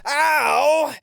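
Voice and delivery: sharp, high, loud cry of pain; pained yelp. Acted. Tied-up partner yelps in pain when jostled, loud and high. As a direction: yelp as if jolted.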